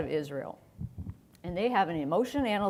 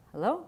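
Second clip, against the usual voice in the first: hello? with a questioning upward lilt; rising hello; 6 semitones higher than usual. Shown as a demonstration. Showing the audience a hello? that goes up. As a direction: lift the end of hello? like a question. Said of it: "it goes up".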